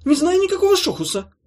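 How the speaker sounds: high, loud squeaky hasty lie; trapped creature denying in a rush; hurried denial. Acted. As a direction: blurt it out fast. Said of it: "торопливо сказала".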